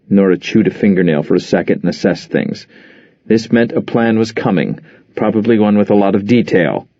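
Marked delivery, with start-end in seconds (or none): none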